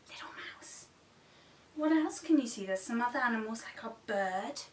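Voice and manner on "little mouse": whisper. Shown as a demonstration. Whispered mouse for toddlers.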